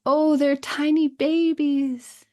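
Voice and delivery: high, loud and slow, melting over the tiny babies; delighted cooing. Pretend. Mother delighting her child in a pretend game, high slow coo. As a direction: coo it out like seeing a newborn.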